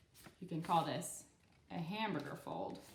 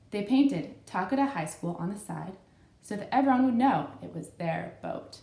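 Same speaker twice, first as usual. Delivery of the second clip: clear, louder read-aloud storytelling; story narration; 7 dB louder than usual. Acted. Host reading a picture book to young kids, storybook narration.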